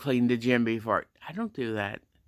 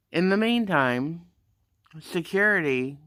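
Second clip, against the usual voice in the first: usual speaking voice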